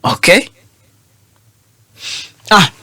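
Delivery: usual speaking voice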